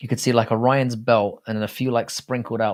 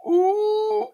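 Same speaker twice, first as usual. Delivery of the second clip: a high, drawn-out ooh of mock pain; much higher ooh after a savage roast; wincing ooh. Real, not acted. Reacting to a harsh chat insult.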